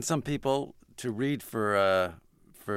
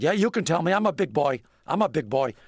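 higher, louder, blustery tough-guy bravado; Steinbrenner voice; 6 semitones higher, 4 dB louder than usual. Retold or imitated. Steinbrenner to Larry about being cut, higher louder bluster. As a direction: play the big boss insisting he can take it.

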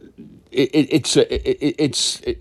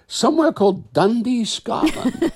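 mock casual reveal; 13 semitones higher than usual. Acting out Peter's casual news.

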